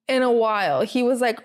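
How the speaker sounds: ordinary delivery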